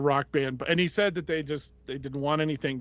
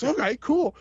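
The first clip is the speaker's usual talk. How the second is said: excited; 11 semitones higher, 5 dB louder than usual. Retold or imitated. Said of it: "He goes".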